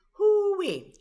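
high, loud hoo-wee, half laughing; a sharp rising whoop of disbelief; exclaim hoo-wee. Real, not acted. Reacting to a loaded question.

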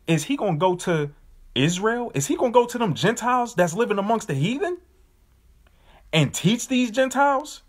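a hint of doubting onlookers; incredulous question.

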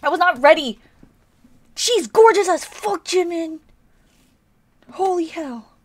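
high, loud exclamation of awe; overwhelmed fan outburst. Real, not acted. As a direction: cry out in starstruck shock. Overwhelmed fan reacts to singer.

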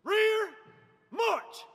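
long rolled rear, then snapped march; drawn out parade commands. Shown as a demonstration. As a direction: shout it as a drill command. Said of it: "emphasizing R in rear".

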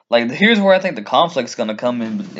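ordinary talk, nothing marked

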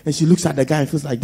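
ordinary talk, nothing marked

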